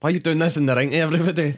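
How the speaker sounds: a hint of an angry wife nagging; mock raging wife. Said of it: "his wife's after him all raging".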